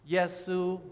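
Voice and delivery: Yesu held high and level, first tone; Chinese first tone. Shown as a demonstration. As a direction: sing Yesu on one high flat pitch. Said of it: "first tone".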